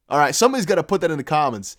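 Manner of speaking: ordinary talk, nothing marked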